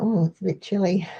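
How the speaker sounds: ordinary talk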